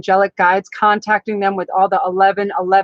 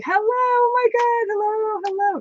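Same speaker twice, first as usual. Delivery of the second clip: excited squeal; 13 semitones higher, 3 dB quieter than usual. Real, not acted. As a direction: squeal the greeting like a delighted fan. Greeting a viewer live.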